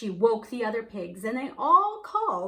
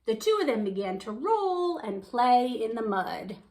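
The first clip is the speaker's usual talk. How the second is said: plain reading voice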